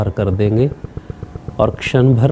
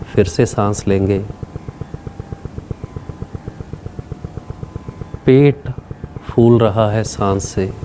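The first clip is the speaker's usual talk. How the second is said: guided meditation; 3 semitones lower, 4 dB quieter than usual. Real, not acted. Leads a breathing meditation.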